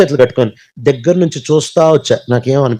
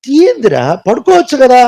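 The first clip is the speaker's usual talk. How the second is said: a hint of a scolding mother, higher and louder; mother calling out; 8 semitones higher, 4 dB louder than usual. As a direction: call out to your son under the bed. Mother scolding son at night. (కొడుకును గద్దించే అమ్మ గొంతు, కొంచెం ఎత్తుగా)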